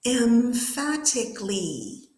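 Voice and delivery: "emphatically" stretched slowly into clear syllables; model pronunciation. Shown as a demonstration. Teacher says it again before the students' turn, slow careful pronunciation. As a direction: say "emphatically" slowly and distinctly for learners to copy. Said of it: "I'm gonna say it again".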